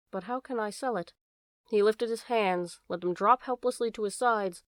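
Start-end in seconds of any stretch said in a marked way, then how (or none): 0.1-1.1 softer, helpless question; said slowly. A narrator's reading. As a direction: let it fall quietly, full of sadness.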